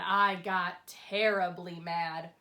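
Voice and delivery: ordinary delivery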